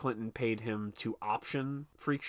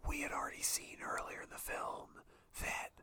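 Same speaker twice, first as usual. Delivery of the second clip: whisper; 13 dB quieter than usual. Shown as a demonstration. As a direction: keep up the mock whisper while explaining. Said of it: "speaking in a whisper like this".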